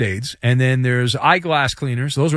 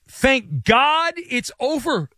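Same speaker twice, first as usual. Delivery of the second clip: higher and drawn out very slowly, quoting the tackle; slow mock relief; 10 semitones higher, much slower than usual. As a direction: stretch each word like a weary sigh of relief.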